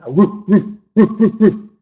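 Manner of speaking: loud playful woof woof dog bark; imitated barking. Telling the mouse story, mimics the cat barking loudly. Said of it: "he hears this barking".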